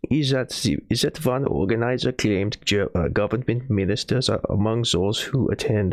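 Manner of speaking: put-on accent slipping from French into German; a hint of a mock German accent. Streamer whose fake French accent turns German. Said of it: "Oh God, I'm turning German now".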